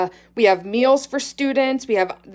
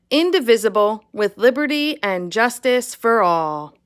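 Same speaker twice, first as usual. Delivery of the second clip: louder, steady group recitation; reciting the pledge; 5 dB louder than usual. Real, not acted. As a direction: recite it firmly and evenly, like a classroom pledge. Leads the Pledge of Allegiance.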